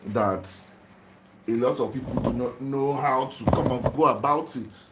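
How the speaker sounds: normal talking voice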